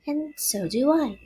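high, small spider voice; little spider. A narrator's reading. Nellie pledges friendship too, small voice.

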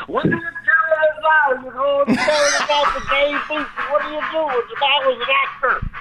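high, interrupting celebrity impression; Bill Cosby. Comic as Bill interrupts himself, high and agitated. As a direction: butt in with a high put-on voice. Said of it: "Bill, I am trying".

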